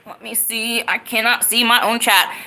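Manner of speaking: ordinary talk, nothing marked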